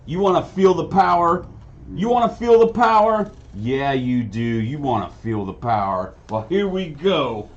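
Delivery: cocky supervillain taunt, opening high and loud; Pulsar. Acted. Supervillain taunting a hero before firing, cocky smack talk. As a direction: goad the hero, big then easing off. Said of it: "sneers down at Deathcloak".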